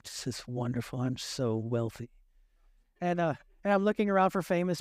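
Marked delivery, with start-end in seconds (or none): 0.0-2.1 mock rich man. Preacher plays himself as a smug rich man in first class, low and soft. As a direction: savour your new wealth, hammed up.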